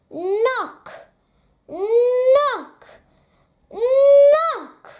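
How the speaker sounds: drawn-out knock words, building to a loud call; mounting knocks. A narrator's reading.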